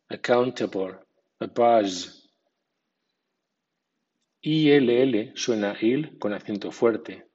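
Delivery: usual speaking voice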